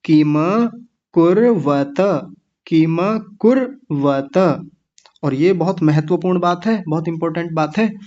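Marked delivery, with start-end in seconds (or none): 0.0-4.6 किम कुर्वत slow, each syllable full; slow joined recitation. Shown as a demonstration. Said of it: "मिलाने पर क्या बनेगा".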